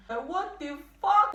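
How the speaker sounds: high, drawn-out yell of disbelief; exasperated yell. Real, not acted. Vlogger venting about her bad luck, yelling high. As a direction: throw the words up in outrage.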